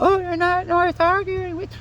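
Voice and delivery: a prissy mock dismissal; mocking high voice. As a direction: sneer it in a squeaky put-on voice. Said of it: "like, it's not".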